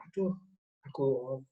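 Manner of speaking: soft, deflated aduh; dejected sigh. Shown as a demonstration. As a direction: let the voice sink as if hurt. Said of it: "jadi ngomongnya itu".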